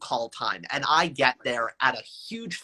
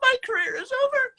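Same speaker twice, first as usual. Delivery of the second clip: squeaky high mock wail, way up top; high, strangled mock lament; mock dramatic despair; faster than usual. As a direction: cry out the words like a tragic melodrama. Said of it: "And I went".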